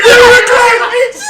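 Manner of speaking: high, loud shrieking accusation; panicked scream. Retold or imitated. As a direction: shriek it out, pointing. Mother screaming, pointing at them.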